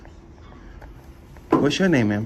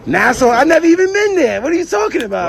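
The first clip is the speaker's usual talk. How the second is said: high, raised protest of innocence; indignant disbelief; 7 semitones higher, 3 dB louder than usual. Real, not acted. Man wrongly told of a warrant protests, indignant disbelief. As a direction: throw the accusation back, pitched high and incredulous.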